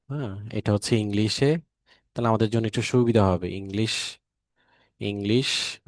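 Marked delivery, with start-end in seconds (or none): none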